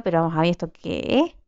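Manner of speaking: rushed puzzled question; quick bewildered ask; fast puzzled question. Real, not acted. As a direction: fire it off quickly, baffled. Baffled by crowd of players.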